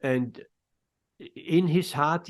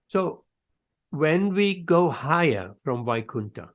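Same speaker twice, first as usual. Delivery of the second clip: ordinary talk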